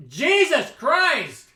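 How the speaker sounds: loud, high and slow exclamation of disbelief; real dismay at the dice; shocked outburst. Real, not acted. Player reacting to a brutal hit, shocked shout.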